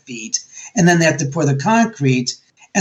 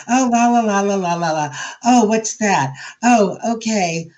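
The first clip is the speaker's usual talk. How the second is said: airy la la la, sung lightly and carelessly; mock carefree singsong; 3 semitones higher than usual. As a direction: hum along without a care, mock-oblivious. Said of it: "I don't sit there and just go".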